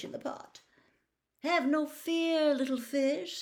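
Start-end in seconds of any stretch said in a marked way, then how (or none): 1.4-3.4 smooth cat voice. Acted. Cat soothing.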